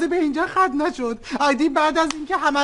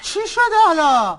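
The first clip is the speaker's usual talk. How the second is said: alarmed shout; 6 semitones higher, 4 dB louder than usual. Acted.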